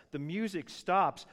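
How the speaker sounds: ordinary talk, nothing marked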